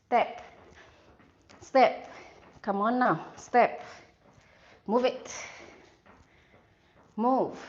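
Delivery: punchy coach calls over music; halftime pep speech. Real, not acted. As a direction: bark out each move to the class. Instructor calling workout cues.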